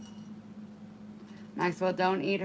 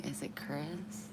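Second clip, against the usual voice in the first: whispering; 11 dB quieter than usual. Real, not acted. Quiet aside reading name.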